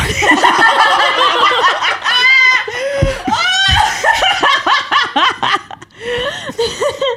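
high shrieking laugh of delight; scream-laugh with joy. Real, not acted. Triumph after dragon tamed.

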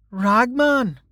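high, puzzled echo of the name; newcomer's voice. A narrator's reading. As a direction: repeat the name as an onlooker, a little below the child. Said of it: "said a new voice".

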